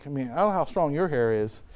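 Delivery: ordinary talk, nothing marked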